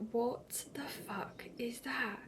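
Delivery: slow, stunned whisper; whispered disbelief. Retold or imitated. Retold private shock as waters go.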